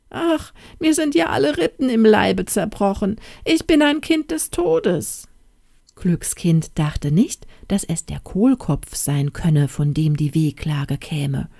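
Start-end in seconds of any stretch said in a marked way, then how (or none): 0.1-5.3 high, pitiful whimpering cabbage voice; pitiful cabbage wail.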